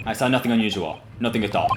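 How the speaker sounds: lightly voiced Russian guard, dismissive; a hint of a Russian sailor, brushing it off; light Russian accent. Guard denying seeing anything, light Russian accent. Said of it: "I might be trying to do their accent".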